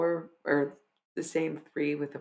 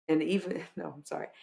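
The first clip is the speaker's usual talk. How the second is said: ordinary delivery, nothing marked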